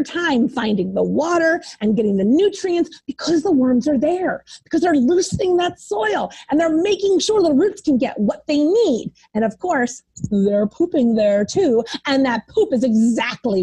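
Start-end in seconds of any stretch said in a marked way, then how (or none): none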